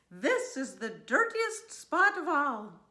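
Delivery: high, loud storybook outrage; appalled cry. A narrator's reading. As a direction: exclaim in dismay at the mess. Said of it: "cries the farmer's wife".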